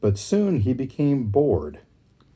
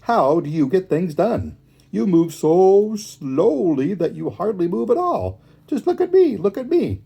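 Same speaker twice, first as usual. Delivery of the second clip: high, excitable hare voice; higher, boastful animal character; demanding hare; 8 semitones higher, 3 dB louder than usual. A narrator's reading. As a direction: pester and show off. Impatient hare badgering the tortoise.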